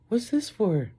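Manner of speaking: high, confused woman voice; baffled high question; high puzzled voice. Retold or imitated. Voicing date's question.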